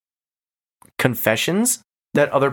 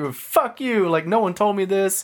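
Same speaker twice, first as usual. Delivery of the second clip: higher-pitched mock indignation; retold pissed-off protest; 7 semitones higher than usual. Employee protesting to his boss, mildly acted pissed-off. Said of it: "he gets pretty pissed about it".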